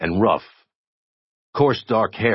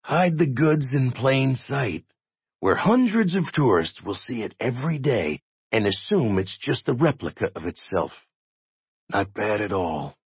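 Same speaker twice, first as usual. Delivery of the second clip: captive detective figuring it out; 6 semitones higher than usual. Acted. Jay works out the hiding place to Dutton, musing. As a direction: think aloud in a higher character voice. Narrator voices Jay working it out.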